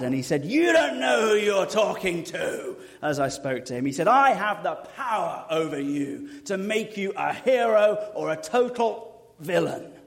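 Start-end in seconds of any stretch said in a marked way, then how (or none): 0.4-2.8 high, loud mock yell held back for the mic; retold angry yell. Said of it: "he started yelling, and I can't yell into the mic like he yelled at me". 4.1-9.9 imitated rage, raised and loud at the start; retold mad yelling. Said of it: "but he went mad".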